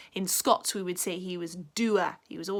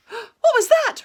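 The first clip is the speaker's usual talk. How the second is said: high, loud frightened cry; fearful call; 13 semitones higher, 12 dB louder than usual. A narrator's reading. Rabbit lost in the mist, high fearful cry. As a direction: call out in fear. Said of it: "Rabbit called fearfully".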